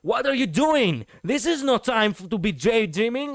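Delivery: high-pitched, forceful scolding; urgent, high, forceful line read. Shown as a demonstration. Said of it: "a little bit more powerful".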